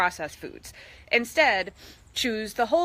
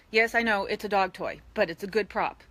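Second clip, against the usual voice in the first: normal talking voice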